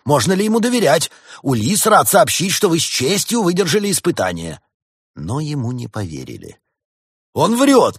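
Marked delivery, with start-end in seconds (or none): 0.0-4.6 high, loud congratulatory bluff; over-eager cover story; bluffing proclamation. A narrator's reading. Doctor bluffs a congratulatory announcement. 7.3-8.0 high, loud cry; outraged shout; shouted accusation. A narrator's reading. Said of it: "кричал делец".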